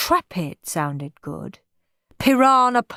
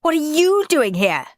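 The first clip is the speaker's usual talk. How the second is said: put-on a lot higher, girlish snap; Margaret snapping; 9 semitones higher, 3 dB louder than usual. A narrator's reading. Margaret snapping at Henry in her treehouse. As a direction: snap back like a bossy girl. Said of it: "snapped Moody Margaret".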